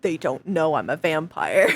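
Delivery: low, hammed-up vampire secrecy; mock vampire. Bird player posing as vampire, low mock-dramatic voice. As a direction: drop deep for a silly vampire confession.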